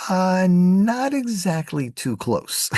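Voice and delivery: very high, slow and stretched-out answer; drawn-out hedging. Retold or imitated. Retelling the answer about the bridge, drawn-out high hedging. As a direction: draw it out high, hedging with mock reluctance. Acted deadpan reply about being far away.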